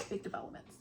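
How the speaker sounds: whispered secret to the camera. Shown as a demonstration. Vlogger teasing viewers with news, whispering. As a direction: lean in and breathe it with no voice. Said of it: "I have big developments".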